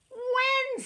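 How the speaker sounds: very high question; hen asking. A narrator's reading. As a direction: cluck it out high. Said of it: "said the hen".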